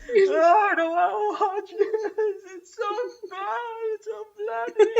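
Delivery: high, wailing imitation of a weeping girlfriend; hammed-up sobbing protest; mock crying. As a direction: whine it through fake tears. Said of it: "She's crying".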